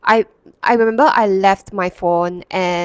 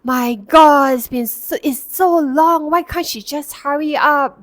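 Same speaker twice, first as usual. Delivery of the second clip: loud, higher mock complaint about the wait; passive-aggressive grumbling; 7 semitones higher, 4 dB louder than usual. Father complaining loudly in the queue, passive-aggressive. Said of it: "it's like those passive-aggressive".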